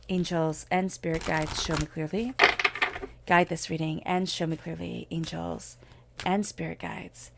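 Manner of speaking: slow, hushed prayer to the angels and guides. Real, not acted. Prayer invoking angels before reading.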